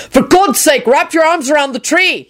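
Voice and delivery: high, exasperated shout; mild shout, pitch pushed up. A narrator's reading. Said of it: "he shouted at her".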